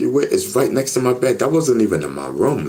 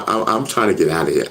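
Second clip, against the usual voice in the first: ordinary delivery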